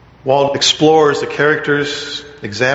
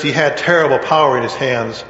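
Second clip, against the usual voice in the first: normal talking voice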